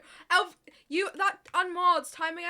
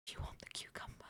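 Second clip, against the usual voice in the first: soft unvoiced whisper; 14 dB quieter, faster than usual. Shown as a demonstration. Streamer mimicking the whispered chat voice, whispering. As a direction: breathe it as a whisper. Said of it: "the whispering".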